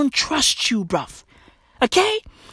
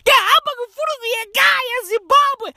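much higher, fast, sobbing Zulu wail; crying wail; 11 semitones higher, much faster than usual. Retold or imitated. Mocked Zimbabwean crying to go home, wailing. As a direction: whimper you want to go home. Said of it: "what happened to your proper Zulu accent?".

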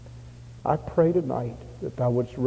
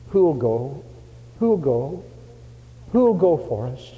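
rising cry; 3 semitones higher, slower than usual. Retold or imitated. Old missionary calls to the London church, building. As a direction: build the appeal step by step. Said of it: "he cried out".

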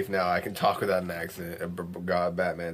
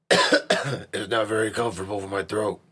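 Batman voice. Acted. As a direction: growl it like a caped vigilante. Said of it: "I gotta talk like Batman".

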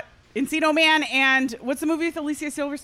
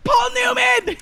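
excited shout; 11 semitones higher, 3 dB louder, slower than usual. Real, not acted. Shouting a quiz guess again.

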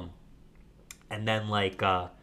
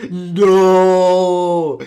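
long, high, loud anguished cry; a villain's defeated wail; 9 semitones higher, 17 dB louder than usual. Retold or imitated. LeBron on his knees after the dunk, loud wail.

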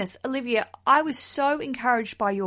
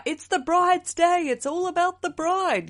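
mock cliché; 9 semitones higher, 4 dB louder than usual. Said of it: "cliche and the traditions are".

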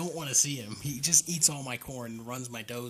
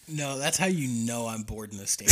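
ordinary talk

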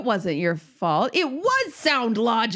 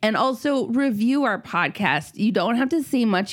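ordinary talk, nothing marked

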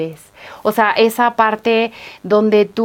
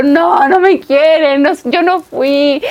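put-on higher, slow whiny child's protest; berrinche de niña; 6 semitones higher, 3 dB louder, slower than usual. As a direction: plead it like a child caught out, dragging the words. Niña regañada niega la culpa. (niña regañada a sus papás, quejido lento y agudo)

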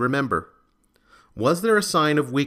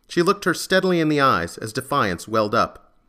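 ordinary delivery, nothing marked

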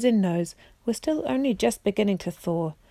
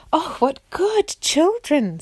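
much higher, slow and sugary; giant queen's voice; 8 semitones higher, slower than usual. Acted. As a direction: coo it like a fat giant queen. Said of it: "said the Queen".

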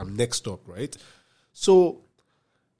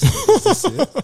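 anxious high squeak; 9 dB louder, slower than usual. Retold or imitated. Checking every message anxiously.

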